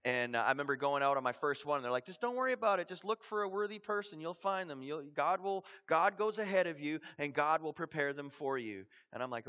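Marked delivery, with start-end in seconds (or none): 2.0-8.8 higher-pitched, upbeat trainer's pep talk; breezy assurance. Retold or imitated. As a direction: reassure a nervous trainee, voice lifted. Trainers reassuring him, retold.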